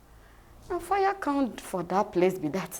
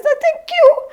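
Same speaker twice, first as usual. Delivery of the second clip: much higher and louder, delighted squeal of thanks; gushing thanks; 14 dB louder than usual. Retold or imitated. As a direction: squeal your thanks, overjoyed. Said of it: "I said".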